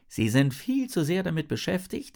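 usual speaking voice

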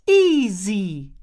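high, loud, delighted exclamation. A narrator's reading. Jin beams at her new friend Isabel, delighted. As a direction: cry the nickname out with delight.

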